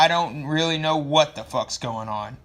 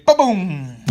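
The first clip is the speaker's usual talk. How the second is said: loud boom sound effect; ba-boom noise; mock explosion; 6 dB louder than usual. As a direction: blurt out a comic explosion. Sound effect of cavalry arriving.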